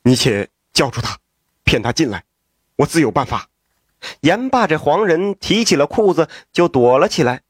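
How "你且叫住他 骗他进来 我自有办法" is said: lower, calm scheming man's voice. A narrator's reading. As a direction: lay out the plan low and cool. The lover plots quietly.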